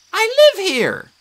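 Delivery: high squeaky nymph, indignant. A narrator's reading. Tree nymph insisting she lives there, squeaky. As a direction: squeak it, a bit offended.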